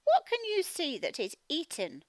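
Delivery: ordinary talk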